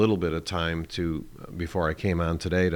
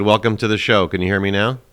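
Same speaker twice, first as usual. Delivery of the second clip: louder for the caller; 10 dB louder than usual. Real, not acted. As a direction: raise the voice so the caller hears. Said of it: "I said".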